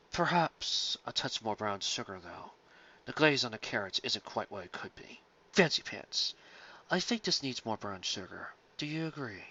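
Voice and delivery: soft, slow, low-key pondering; scholarly critic. A narrator's reading. Night Court pony critiquing the salad, soft and scholarly. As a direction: weigh every word like a serious scholar.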